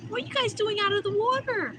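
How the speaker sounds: high-pitched chiding coo to animals. Real, not acted. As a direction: ask as if talking to toddlers. Questions the ducks like babies.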